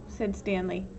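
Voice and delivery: ordinary delivery, nothing marked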